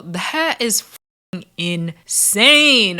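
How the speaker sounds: drawn-out, very slow indignation; angry disbelief. Real, not acted. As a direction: stretch each word out in outrage. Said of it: "I'm angry just thinking about it".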